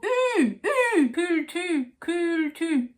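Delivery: high "ooh" calls turning into slow French "cul-tu"; French horse call. Shown as a demonstration. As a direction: carry the pursed "ooh" into "cool too". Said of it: "imagine you're on a French horse".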